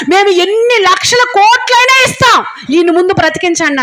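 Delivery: high, loud crying plea to the doctors; imitated desperate plea. Family begging hospital doctors to save him, high crying plea. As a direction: beg the doctors through tears. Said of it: "అంటున్నారంట".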